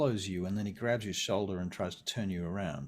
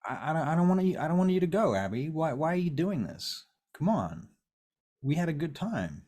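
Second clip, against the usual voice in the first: higher, louder begging with stumbles; desperate pleading; 7 semitones higher, 4 dB louder than usual. Acted. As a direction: beg someone not to walk out. Vern begs Abby not to go.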